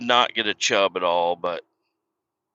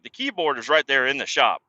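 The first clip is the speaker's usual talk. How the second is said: ordinary delivery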